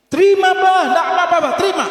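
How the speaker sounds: put-on very high, loud girlish begging; pleading daughter. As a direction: urge your father, almost squealing. Daughter begs father to accept.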